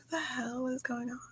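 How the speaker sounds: hushed, puzzled retelling; baffled aside. Retold or imitated. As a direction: drop to a soft, bewildered self-question. Retold private bafflement.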